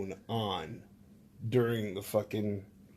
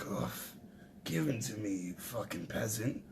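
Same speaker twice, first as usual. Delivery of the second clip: disgusted sneer; 3 semitones lower, 11 dB quieter than usual. Retold or imitated. Disgusted steward.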